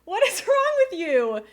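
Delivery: high, loud mock scolding; delighted mock-scold; excited shriek. Real, not acted. Overwhelmed by donor's gifts.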